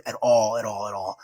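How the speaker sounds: usual speaking voice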